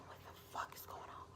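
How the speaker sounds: whispered under the breath; barely audible, voiceless aside to yourself; baffled whisper. Retold or imitated. Teller retells private bafflement in the back-room episode, whispered. Her secret baffled reaction.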